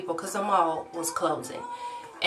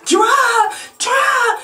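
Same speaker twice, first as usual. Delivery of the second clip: much higher, loud frantic urging; panicked friend; 9 dB louder than usual. Retold or imitated. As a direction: yell it like the car is chasing you. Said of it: "my friend just like".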